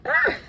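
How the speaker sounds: sudden high, loud cry of dismay; a quick shocked "ah" at a wet seat; startled high yelp. Real, not acted. Mom finds her car seat wet, startled yelp.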